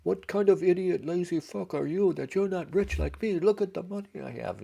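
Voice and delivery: very high, loud pitchman tirade; hammed-up yelling. Commercial guy on his speedboat berating viewers, mock yelling. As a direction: yell it as a bragging infomercial huckster, way up high. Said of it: "he's yelling at you".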